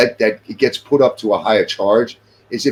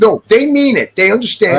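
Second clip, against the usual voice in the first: louder, higher, insistent contradiction; real heated insistence; emphatic rebuttal; 7 semitones higher, 5 dB louder than usual. Real, not acted. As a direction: shoot the excuse down forcefully. Emphatically rebuts the mocked excuse.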